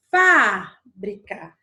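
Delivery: fábrica drawn out slowly with a loud first syllable FÁ; stress on first syllable. Shown as a demonstration. Teacher showing students an accent example, first syllable of fábrica stressed. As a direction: stretch and punch the FÁ of fábrica, then drop. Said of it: "Quando eu digo fábrica".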